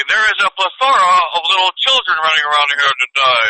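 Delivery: mock Hollywood-elite accent; hoity-toity Rodeo Drive accent. Cabal members chatting pinky out, hoity-toity Hollywood accent. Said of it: "What kind of fucking accent was that?".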